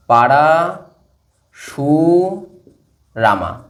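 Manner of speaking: very slow, syllable by syllable; drawn-out dictation. Shown as a demonstration. Teacher writing the answer for students, drawn-out dictation. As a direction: stretch each syllable as if writing it.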